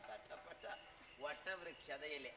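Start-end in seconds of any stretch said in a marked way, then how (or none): 1.2-2.3 slower, lower, word by word; measured, careful repeat; slow clarification. Acted. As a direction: spell out ವಟವೃಕ್ಷದ slowly for the listener. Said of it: "ಅದೆಂತ ನಿನ್ನ ತಟಪಟ".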